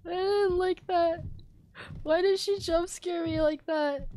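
high, shaken complaint. Real, not acted. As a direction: whimper it after the scare. After jump scare.

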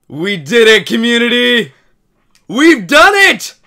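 screaming with joy, loud and high; ecstatic shout. Real, not acted. Streamer screams to the community about the long-awaited remake. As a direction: yell it like your dream came true.

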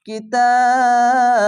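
কিতাব drawn out slowly, ending on a bounced qalqalah ba; tajweed demo of কিতাব with qalqalah; Quranic recitation. Shown as a demonstration. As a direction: chant কিতাব long and end with a bounce. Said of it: "সাকিন দিবেন, দিয়ে কলকলা হবে".

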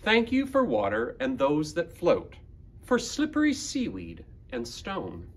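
expressive picture-book poem reading with long pauses; reading to children. Acted. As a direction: read it like a gentle love letter to the planet. Reads picture book aloud.

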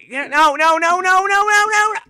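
real panic yelling no; panicked screaming. Real, not acted. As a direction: scream no over and over as you lose. Losing fight in game.

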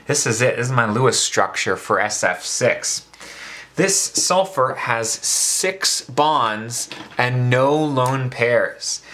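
ordinary talk

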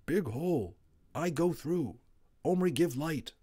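a tiny brave figure calling up from under the floor; Little Bear voice. A narrator's reading.

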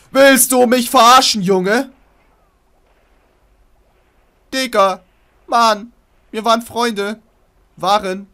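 high, full-volume yell of betrayal; outraged shouting. Pretend. Player yells at the monster that was his friend, outraged shouting. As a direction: scream at a friend who betrayed you, hammed up.

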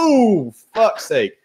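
high, drawn-out yelp of pain, then a curse; acted pain. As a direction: howl as if a nerve got hit. Shows pain of hitting exposed nerve.

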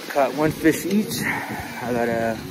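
ordinary delivery

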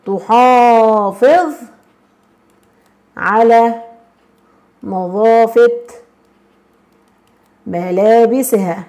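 each word drawn out and spaced apart; slow dictation pace, long pauses between words. Shown as a demonstration. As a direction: dictate slowly so students can write. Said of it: "أقول".

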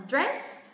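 "dress" said slowly with the pitch climbing at the end; rising tone. Shown as a demonstration. Teacher shows learners the pitch going up, rising tone on "dress". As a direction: lift the pitch upward on "dress". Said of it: "thay vì chúng ta lên giọng".

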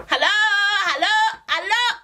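high, slow sing-song hello; calling out. Retold or imitated. Calling into the house at the door, slow and high. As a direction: stretch each hello out to the house.

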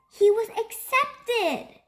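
high, drawn-out happy news; delighted announcement. A narrator's reading. Narrator tells listeners of owl's surprise acceptance, delighted.

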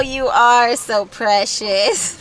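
normal talking voice

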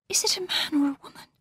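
high, hushed, hurried fearful question; shaking with fear. A narrator's reading. Laura asks about the figure behind them, hushed and fearful. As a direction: ask it fast and softly, terrified. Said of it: "She was shaking with fear".

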